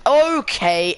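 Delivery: loud, high drawn-out "okay"; startled reaction. Real, not acted. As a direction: burst out at a sudden crash on track. Reacting to a crash live.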